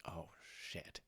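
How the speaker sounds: soft unvoiced whisper of dread. Two friends realizing they're caught, whispered dread. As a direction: breathe it out like you're caught.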